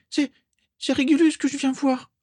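very high, timid little voice; falter, then squeak out the excuse; squeaky stammer. A narrator's reading. Mimi cornered, saying she came for Régulus, squeaking.

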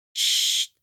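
breathy unvoiced hush; a whispered chut; whispered shush. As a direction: shush them under your breath. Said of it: "chuchota Sirius".

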